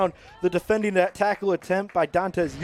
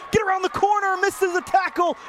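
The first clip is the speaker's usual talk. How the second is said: touchdown call; 11 semitones higher than usual. Real, not acted. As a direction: yell it as he breaks into the end zone. Play-by-play as runner scores touchdown.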